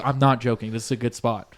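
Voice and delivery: soft, low earnest insistence; quiet and solemn, no joking; dead serious. Retold or imitated. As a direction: imitate a friend sincerely vouching. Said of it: "he's one hundred percent serious".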